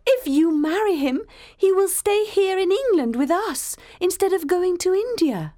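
very high, eager woman's voice; delighted Diana. A narrator's reading. Diana hoping her brother stays in England, delighted and high. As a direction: gush hopefully, pitched right up.

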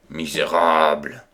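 roaring insult. Acted. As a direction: bellow the insult in fury. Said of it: "rugirent les gentilshommes".

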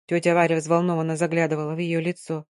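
plain reading voice

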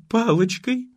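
high, plaintive little bleat. Acted. The aunt pitifully admits she hit him with a stick, a plaintive high bleat. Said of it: "жалобно проблеяла тётка".

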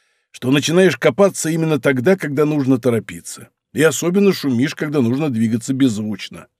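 ordinary delivery

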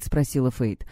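plain reading voice

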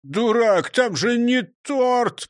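high, loud, slow scolding; hissed rebuke. A narrator's reading. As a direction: snap at a foolish partner. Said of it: "прошипел Крендель".

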